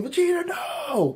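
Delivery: high, drawn-out cry of no; yelling in despair. Acted. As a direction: call out as if watching him fall. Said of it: "everyone else is yelling".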